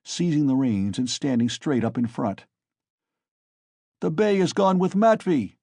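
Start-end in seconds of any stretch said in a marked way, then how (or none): 4.0-5.6 raised, high call; rowdy shout; shout from the cart. A narrator's reading. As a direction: bellow it over the crowd. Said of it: "he shouted from the cart".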